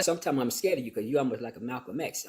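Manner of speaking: usual speaking voice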